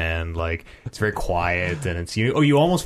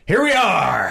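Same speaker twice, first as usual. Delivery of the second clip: higher, louder pumped-up entrance; hype shout; 8 semitones higher, 4 dB louder, much slower than usual. Pretend. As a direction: burst in like a fighter ready to go. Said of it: "getting ready for a fight".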